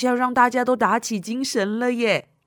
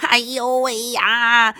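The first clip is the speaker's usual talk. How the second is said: slow, louder laughing wince; laughing groan; 4 semitones higher, 7 dB louder, slower than usual. Acted. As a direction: laugh at an awful sound. Reaction to bad singing.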